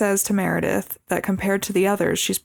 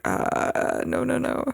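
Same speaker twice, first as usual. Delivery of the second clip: mock horrified Nick; 4 semitones higher, slower than usual. Nick's face reacting.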